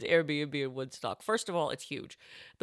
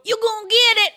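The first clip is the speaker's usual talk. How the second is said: high, loud backwoods holler; sitcom catchphrase; 11 dB louder than usual. Acted. Sitcom character entering a room, hollered backwoods catchphrase. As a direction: belt out the hillbilly tagline. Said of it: "What if that's your tagline?".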